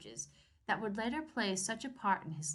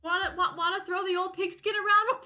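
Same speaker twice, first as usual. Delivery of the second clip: higher, louder hammed-up jolly father; mock cheery dad; 8 semitones higher, 6 dB louder than usual. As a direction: ham up a chummy sporty dad. Parodying dad's invitation.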